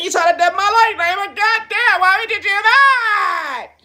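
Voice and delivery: high, loud anguished wail, slowing at the end; loud mock despair rising into a crying shout; outraged crying shout. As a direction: howl the complaint as if wronged, drawing out the last line. Acted outrage at being wronged.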